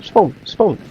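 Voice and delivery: high, pressing spawn spawn; urgent urging. Real, not acted. Urging respawn mid-battle.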